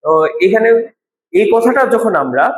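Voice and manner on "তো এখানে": ordinary talk, nothing marked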